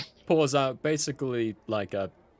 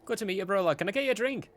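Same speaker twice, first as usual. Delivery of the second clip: lightly voiced eager innkeeper, higher pitch; [name]; 9 semitones higher, faster than usual. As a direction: greet the customer with a cheerful lift. Innkeeper greets a new customer.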